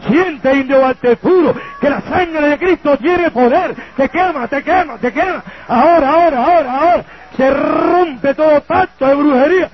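preaching rebuke. Acted. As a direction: cast the witch out with force. Reprende a la bruja y al espíritu. (reprensión a gritos contra la bruja)

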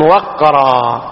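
"وقرا" with an a after the waw, waqra; slow, drawn-out "وقرا" as WAQ-raa; "وقرا" pronounced waq, not wiq; fatha on the waw. Shown as a demonstration. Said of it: "بفتح الواو".

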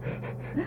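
a quiet, unvoiced chuckle; a short laugh under the breath; soft breathy laugh. Real, not acted. [name] laughs at Astra's retort. (zacht lachje)

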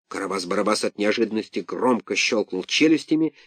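ordinary delivery, nothing marked

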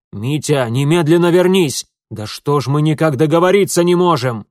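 frustrated shouting after someone leaving; commanding call. A narrator's reading. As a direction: order them back at once. Father calls son back angrily.

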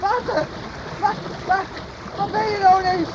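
high, loud frantic calls; frantic shouting. Acted. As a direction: shout for a lost friend. Searching for vanished friend.

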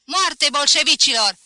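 high, very loud battle cry; thundering slogan. A narrator's reading. Professor raising his voice to rouse students, thundering shout. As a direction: thunder it out over the crowd. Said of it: "Ridică glasul și tună".

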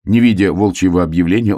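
ordinary delivery, nothing marked

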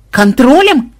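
high, loud incredulous echo; astonished. A narrator's reading. Мелисса echoes the claim that all is under control, astonished. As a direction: blurt it back in amazement. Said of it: "изумилась Мелисса".

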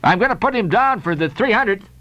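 brisk, blustering shipowner; insistent captain. Acted. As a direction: lay down the decision firmly. Peleg overrules Bildad.

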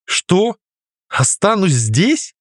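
high then slow, stunned; disbelieving she must stay; shocked. A narrator's reading.

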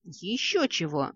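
ordinary delivery

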